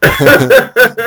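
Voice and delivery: short rising giggle; a quick high laugh. Real, not acted. Host giggling at his struggling co-host. Laughs while asking if okay.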